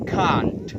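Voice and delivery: can't with long British "ah" vowel; British pronunciation. Shown as a demonstration. Teacher showing the short form of cannot, British long-vowel can't. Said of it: "dalam versi British".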